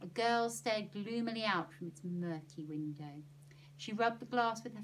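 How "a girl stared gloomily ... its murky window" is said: plain reading voice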